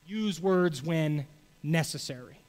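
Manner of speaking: slow, weighty quotation; slow quoted saying. Retold or imitated. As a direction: draw out each word of the saying. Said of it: "but it's".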